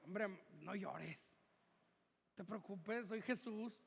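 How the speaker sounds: low, hushed reassurance, acted; soft consoling. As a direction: quietly soothe someone who is crying. Imagined consoling words of Jesus.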